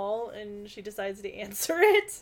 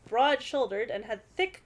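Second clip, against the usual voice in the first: ordinary delivery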